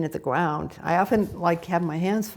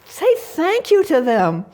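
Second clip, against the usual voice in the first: slow, higher, louder command; firm insistence; 8 semitones higher, 5 dB louder, slower than usual. Retold or imitated. Hospice worker telling a dying man to thank family, slow and firm. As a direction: spell it out slowly, firmly. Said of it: "I said".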